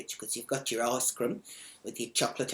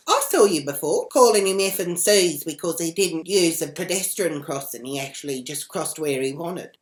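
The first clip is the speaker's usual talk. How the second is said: loud, indignant re-enacted scolding; angry telling-off; 3 semitones higher, 8 dB louder than usual. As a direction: tell them off loudly, pitched up. Teller scolding woman in retold row.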